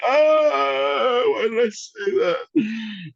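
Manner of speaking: high, slow anguished groan; cringing regret. Retold or imitated. After a botched talk with a crush, high cringing regret. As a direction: kick yourself over it.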